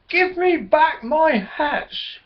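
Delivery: high, slow, cross demand. Acted. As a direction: make the starlings give the hats back. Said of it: "how cross Billy Blackbird felt".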